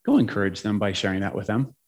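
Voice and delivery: low, softened instruction; quietly direct, as an inner divine prompting; voice of God. Retold or imitated. God giving a second sentence, low retold prompting. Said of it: "then came the second sentence".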